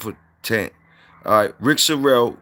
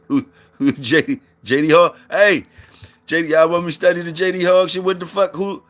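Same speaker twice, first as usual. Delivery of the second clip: surprised outburst; 7 semitones higher than usual. Real, not acted. Shocked at chat's J D pick.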